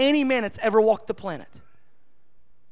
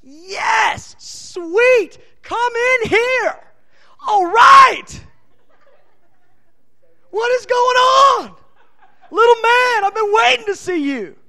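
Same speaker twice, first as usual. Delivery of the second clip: much higher, loud, laughing and shouting with delight; God thrilled to greet a believer, laughing shout; overjoyed God welcoming; 10 semitones higher, 9 dB louder, slower than usual. Acted. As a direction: burst out in joyful welcome like a father seeing his child. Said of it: "he goes".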